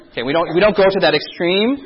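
usual speaking voice

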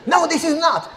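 hammed-up bossy elder; scolding elder. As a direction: brush him off impatiently. Elder insisting.

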